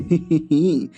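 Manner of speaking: a short, sly mocking giggle. Ninth princess teasing her sister, sly giggle. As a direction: giggle like she is up to mischief.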